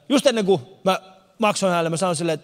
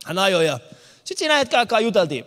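ordinary delivery

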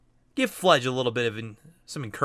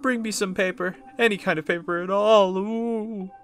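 higher, wailing spooky plea; moaning ghost; 8 semitones higher than usual. Acted. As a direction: moan for paper like a ghost. Voices the toilet-hand ghost.

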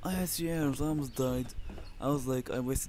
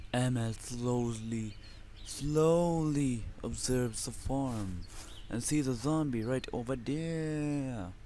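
slow narration; slower than usual. Pretend. Narrating own character in third person.

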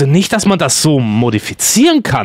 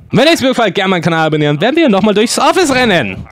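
usual speaking voice

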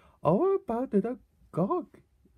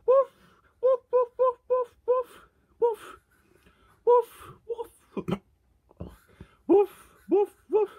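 high, louder pretend woofs; imitated dog bark; 12 semitones higher, 5 dB louder than usual. Barking like a dog between remarks, high imitated woofs.

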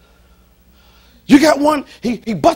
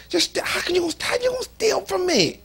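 indignant; 7 semitones higher, 6 dB quieter than usual. Real, not acted. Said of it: "I was hotter than fish grease".

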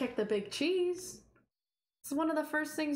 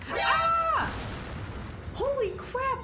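frightened scream; 9 semitones higher, much slower than usual. Real, not acted. Gamer scared by the boss, shrieking.

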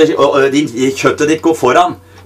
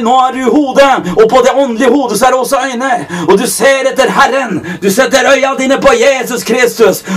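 full-force preaching; 7 semitones higher than usual. Acted. Preacher urging the congregation to starve the flesh, fervent. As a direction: preach it hard, pushing each line.

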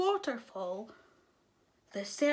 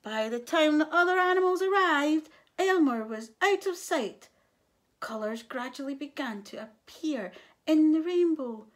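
ordinary delivery, nothing marked

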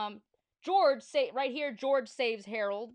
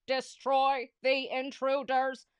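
slow robot drone; 4 semitones higher, 6 dB louder, slower than usual. Acted. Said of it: "said the robots".

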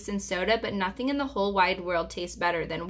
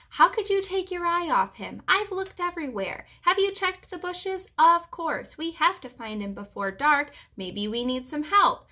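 higher, agitated back-and-forth bickering; worried searchers squabbling in raised pitch; arguing; 8 semitones higher than usual. A narrator's reading. Searchers argue over who lost the hamster, arguing. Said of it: "calling my name and arguing among themselves".

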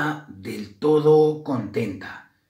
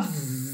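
sustained buzzing, softer; bee buzz; 3 semitones higher, 7 dB quieter than usual. A narrator's reading. Bees chase Grisela, droning buzz. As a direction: imitate bees zooming after someone.

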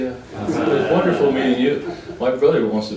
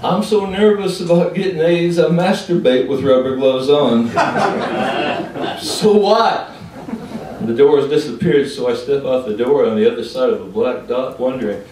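loud, raised delivery settling gradually; poem declamation; 5 semitones higher, 7 dB louder than usual. Acted. Poet confessing nerves to the audience, loud declamation. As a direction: declaim it forcefully.

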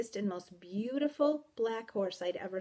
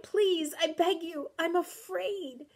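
high, desperate begging; frightened, drawn-out plea; crying out; 9 semitones higher, slower than usual. A narrator's reading. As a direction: plead in fear. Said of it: "I cried".

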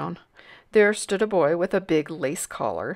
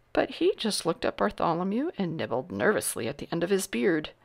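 plain reading voice

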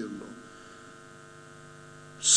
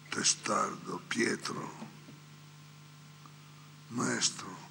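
lower, whispered Maestro; whispered plea; 9 semitones lower, 11 dB quieter, slower than usual. Acted. Peter answering Jesus, humble whisper. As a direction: breathe the word humbly.

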